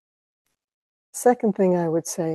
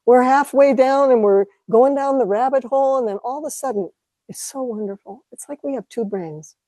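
usual speaking voice

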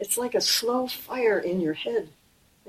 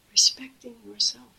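whispered; 3 semitones higher than usual. Real, not acted. Intimate teaching aside.

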